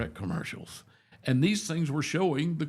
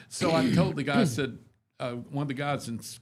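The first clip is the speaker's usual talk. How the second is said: usual speaking voice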